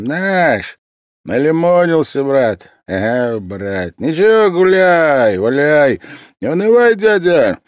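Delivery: громко, высоко, развязный пьяный студент; разгульно орать, похлопывая по-братски; пьяный голос. A narrator's reading. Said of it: "сказал пьяным голосом". (пьяный студент громко и высоко лезет к Васильеву)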